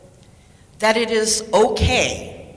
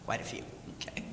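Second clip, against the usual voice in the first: whisper; 10 semitones lower, 19 dB quieter, much faster than usual. Real, not acted. Aside after counting raised hands.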